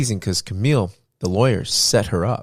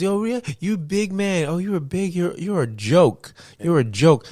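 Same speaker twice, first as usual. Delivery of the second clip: higher, slower mocking imitation; imitated taunting; 9 semitones higher than usual. Wife taunting husband on tape, higher slow mocking jeer. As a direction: sneer it, higher and drawn out, like goading him. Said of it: "the way she's taunting him".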